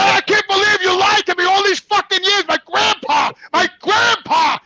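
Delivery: high, loud, wailing accusation; retold anguished outrage; betrayed yelling. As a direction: act out yelling at mother, stretching the last words. Retold yelling at mother over lie.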